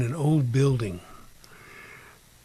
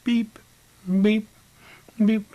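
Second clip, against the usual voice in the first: high, short, evenly spaced beeps; loud high electronic-style beep with gaps; imitated machine beep; 9 semitones higher, 6 dB louder, slower than usual. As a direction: mimic an alarm meter's single beeps. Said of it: "I hear a beep".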